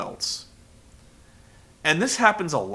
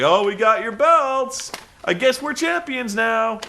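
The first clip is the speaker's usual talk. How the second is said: mocking smugness; 8 semitones higher, 4 dB louder than usual. Mocking belt thieves.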